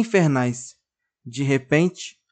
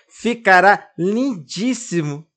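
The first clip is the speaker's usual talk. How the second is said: slow, gleeful exclamation; teasing cry of joy; exclaiming; 5 semitones higher, 4 dB louder, slower than usual. A narrator's reading. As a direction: sell it as fake delight. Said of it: "exclama Malaquias".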